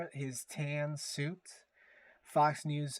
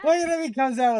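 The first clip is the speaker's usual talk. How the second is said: loud, fast delighted exclamation; louder and quicker, thrilled; delighted outburst; 10 semitones higher, 12 dB louder, faster than usual. Real, not acted. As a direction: burst out with glee at the clip. Delighted at the clip.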